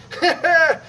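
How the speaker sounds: high, loud burst of laughter; real laugh; pleased laugh. Real, not acted. After the strike exercise.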